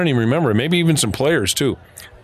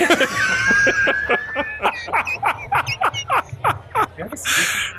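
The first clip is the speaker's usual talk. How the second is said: high wheezing laugh; big laugh. Real, not acted. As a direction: burst out laughing at the memory. Laughing at own story.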